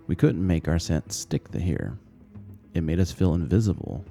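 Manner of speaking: usual speaking voice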